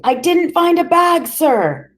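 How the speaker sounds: high, loud screaming denial; boy pinned by the throat shouting at police; terrified shout. Acted. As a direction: scream it against the wall. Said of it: "and I was shouting".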